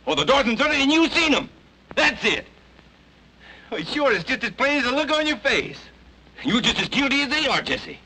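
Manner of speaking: high, rising, pressing accusation; heated accusation. Acted. Ben pressing Jesse to confess, heated and accusing. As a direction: corner him with the truth, voice climbing.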